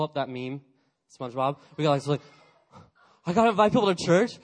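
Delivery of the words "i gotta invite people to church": mock panic. Panicked student after being told to invite.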